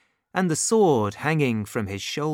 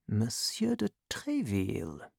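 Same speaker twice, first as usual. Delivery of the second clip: hushed, softer private thought; thinking to oneself; 2 semitones lower, 8 dB quieter than usual. A narrator's reading. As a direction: muse under your breath. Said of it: "thought the stranger".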